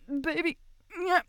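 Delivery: loud, high climactic crying out; ecstatic cries. Acted. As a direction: let it break into full-throated ecstasy.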